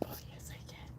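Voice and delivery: breathy whisper to herself. Real, not acted. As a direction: whisper it under your breath. Fiddling with zoom live.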